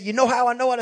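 high, loud retold child's question; acted boy bursting to explain; excited insistence. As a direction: press it eagerly, as an excited boy. Retold son excitedly presses his point.

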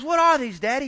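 put-on high, loud little boy's question; a small boy asking his daddy about tickets; curious child. Boy asking his father what the tickets are, high curious voice.

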